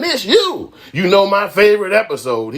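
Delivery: high, gushing recognition; mock excited fan. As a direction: squeal it like a fan spotting a star. Acts fan pestering grown-up Dudley.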